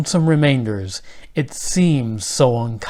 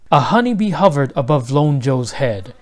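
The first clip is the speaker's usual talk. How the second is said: usual speaking voice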